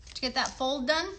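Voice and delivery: usual speaking voice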